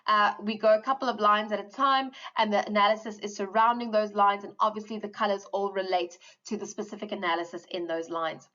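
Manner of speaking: normal talking voice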